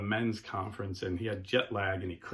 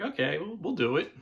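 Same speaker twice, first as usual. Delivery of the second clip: hesitant agreement; 6 semitones higher than usual. Retold or imitated. Hooker agreeing to party.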